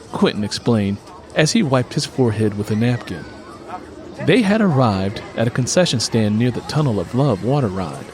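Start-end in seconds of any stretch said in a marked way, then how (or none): none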